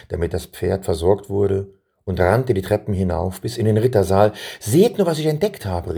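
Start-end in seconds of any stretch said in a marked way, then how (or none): 4.6-6.0 high, eager exclamation; excited call. A narrator's reading. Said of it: "rief er aus".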